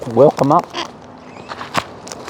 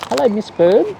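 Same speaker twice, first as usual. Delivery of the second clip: higher, sing-song hello to the bird; high greeting; 9 semitones higher than usual. Real, not acted. Owner greeting Miss Bird in a higher sing-song. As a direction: lift the voice up for the pet.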